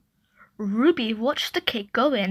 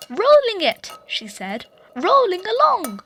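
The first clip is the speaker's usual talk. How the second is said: put-on high little girl echo; toddler echo; 9 semitones higher, 4 dB louder than usual. A narrator's reading. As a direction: copy Mum in a bright small-child voice. Ruby playing.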